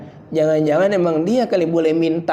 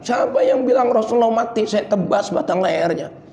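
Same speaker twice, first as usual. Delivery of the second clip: quick raised mock outburst; Umar grieving; 6 semitones higher than usual. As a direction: Umar refusing to accept it, fast. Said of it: "Sambil kucur air mata". (Umar marah sambil menangis)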